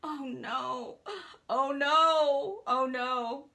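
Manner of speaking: acted moan swelling loud in the middle. Man caught under golf cart, acted moaning. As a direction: moan it out as if hurt. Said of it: "in the back moaning".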